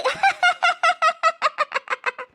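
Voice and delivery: long, high peal of giggles; high rapid-fire giggle; giggling fit. Acted. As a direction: burst into a run of high laughter. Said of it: "ഇങ്ങനെ ചിരിക്കുന്നെ".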